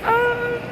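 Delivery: high airy laugh breaking into a sob; overwhelmed laugh that cracks; laugh-cry. Real, not acted. Friend overwhelmed by the film, high teary laugh.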